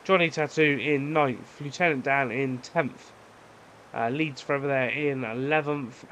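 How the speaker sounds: ordinary talk, nothing marked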